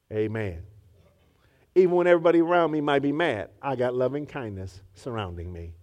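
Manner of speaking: low, soft, tender; tender refrain. Acted. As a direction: sing it gently low.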